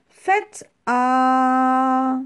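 high, loud, long held aah; doctor's demonstration. Acted. Doctor examining the wolf, high loud held aah. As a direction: hold the vowel out loud and steady.

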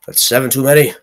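high, loud, disbelieving outburst; startled protest with a laugh; indignant surprise. Real, not acted. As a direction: call out the cheap trick loudly. Enemy sets campfire trap.

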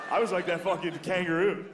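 usual speaking voice

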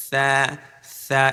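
th-sound "সা" without any pressure; unpressed, airy "সা"; soft letter. Shown as a demonstration. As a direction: say "সা" gently lisped, tongue tip out. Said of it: "সা হচ্ছে নরম হরফ".

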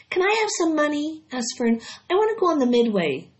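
put-on high, pleading child voice; eager young girl. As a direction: beg your mom eagerly. Fern begs for money.

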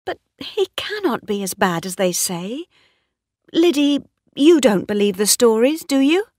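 high, anxious appeal; worried voice. A narrator's reading. Mistress asks Liddy about the stories, worried. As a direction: seek reassurance, pitch climbing. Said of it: "said in a worried voice".

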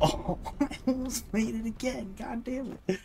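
high laughing groan; laughing frustration. Real, not acted.

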